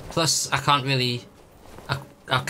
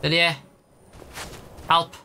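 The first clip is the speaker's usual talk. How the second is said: calling out; 3 semitones higher than usual. Real, not acted. Calling for companion in game.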